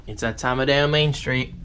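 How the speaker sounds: normal talking voice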